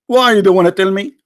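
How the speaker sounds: usual speaking voice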